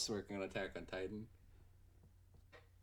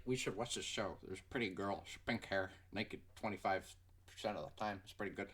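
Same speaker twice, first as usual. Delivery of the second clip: lower, softer dopey friend voice; mocking Jake; 5 semitones lower, 5 dB quieter than usual. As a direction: parody your friend lower and duller. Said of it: "he's like".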